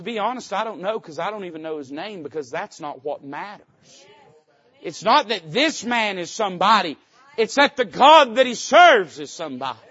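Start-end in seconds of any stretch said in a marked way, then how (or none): 4.8-9.8 loud, higher preaching cry; rising sermon emphasis; preaching at full force. Real, not acted. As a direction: build the point to a shout. Preaching climax.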